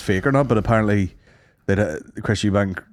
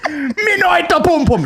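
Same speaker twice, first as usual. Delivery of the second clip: high, loud, slow mock Jamaican accent; hammed-up patois line through laughter; 4 dB louder than usual. Joking about the boxer's mother tongue, mock Jamaican accent. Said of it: "launched into the Jamaican".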